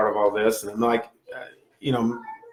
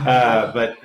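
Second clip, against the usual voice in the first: laughing; 5 dB louder than usual. Real, not acted. Laughing at own remark.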